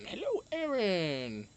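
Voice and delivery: stretched-out slow hello; slow greeting. Real, not acted. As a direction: draw the greeting out. Drawn-out greeting.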